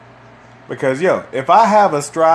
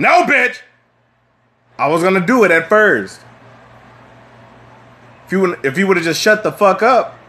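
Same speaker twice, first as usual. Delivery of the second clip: angry rant; 5 semitones higher than usual. Acted. Ranting at imagined nagger.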